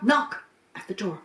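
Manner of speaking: plain reading voice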